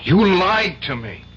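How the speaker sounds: angry accusation. Acted. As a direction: throw the betrayal back at him. Lawyer discovers client lied.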